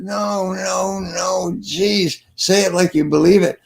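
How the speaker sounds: high, drawn-out exasperated protest; exasperated coaching. Retold or imitated. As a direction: plead with the crowd to mean it. Coach rejecting second weak attempt.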